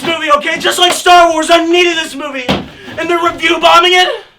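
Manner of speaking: loud, high, tearful rage; furious ranting. Acted. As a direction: scream at the injustice, near tears. Furious breakdown over the movie.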